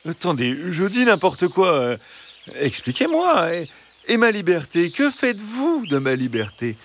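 high, pleading fox voice; imploring. Acted. Fox begging the hens for his liberty, high and imploring. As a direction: plead plaintively for your freedom. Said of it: "implora le renard".

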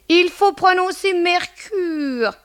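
high, slow, prissy correction; pursed-mouth affectation. Retold or imitated. Landlady mimicking a tenant's correction, pursed and affected. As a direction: purse the lips and draw it out high. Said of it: "la bouche en cul de poule".

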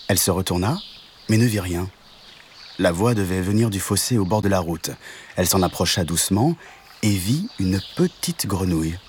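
usual speaking voice

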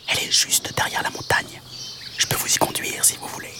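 whispered little mantis; tiny whispered voice. Acted. Praying mantis asking from the bush, whispered. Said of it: "une toute petite voix".

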